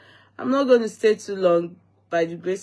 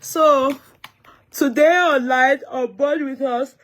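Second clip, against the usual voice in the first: higher and louder, bright welcome; excited greeting; 7 semitones higher, 5 dB louder than usual. Real, not acted. Host hailing online viewers, excited and louder. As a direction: hype up the stream opening.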